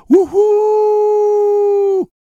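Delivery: very high, loud whistle blast; long loud happy train whistle wouhou. A narrator's reading. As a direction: blow the whistle for pure joy. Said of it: "montrer comme il était heureux".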